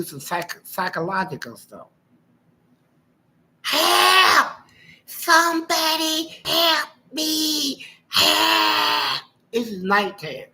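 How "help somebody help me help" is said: high, loud fake cry of help; mock woman's scream for help. Imitating his wife screaming for help out the car window, high mock shriek. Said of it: "She was hollering".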